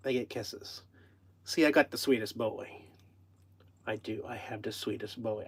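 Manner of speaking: low, hushed doting on a pet; tender murmur. Real, not acted. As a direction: murmur lovingly to your sweet cat. Murmuring affection to the pet cat.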